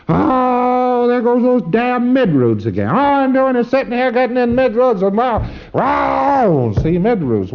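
high, wailing mock complaint; hammed-up outburst from an exasperated client; griping preclear. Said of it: "be shaken up by a P C who says".

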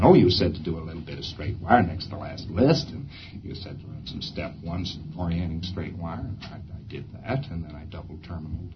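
low, subdued, mumbling auditor; a hint of a sheepish auditor making excuses; mumbled excuses. Auditor sheepishly reciting his session to the supervisor, low and mumbled.

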